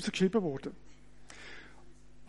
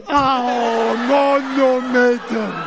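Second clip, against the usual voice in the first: louder, slow, laughing ouch; mock pain cries; 2 semitones higher, 7 dB louder, much slower than usual. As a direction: ham up getting hit. Getroffen, gespielte Schmerzensrufe. (gespieltes Aua, lauter und lachend)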